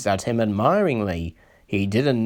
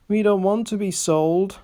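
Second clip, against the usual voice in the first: high, whiny misery; plead, close to tears; miserable; 9 semitones higher than usual. A narrator's reading. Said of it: "said Peter Sam miserably".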